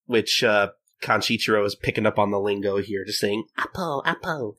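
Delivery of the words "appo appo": put-on higher, softer baby parroting the word. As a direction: coo it like a toddler copying a grown-up. Baby Kanshiro parroting the lingo.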